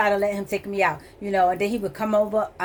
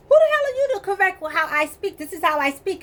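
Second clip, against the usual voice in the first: indignant retort; 8 semitones higher than usual. Retold or imitated. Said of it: "I'm looking at him like".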